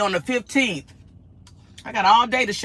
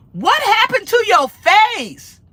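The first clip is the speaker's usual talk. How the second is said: loud, high, drawn-out mock outrage; high-pitched hammed-up troll heckle; mock outraged questioning; 13 semitones higher, 7 dB louder, much slower than usual. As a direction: imitate a rude troll, stretching every word. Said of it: "they said these exact words".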